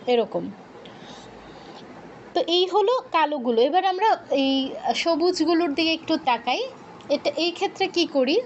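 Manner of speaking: usual speaking voice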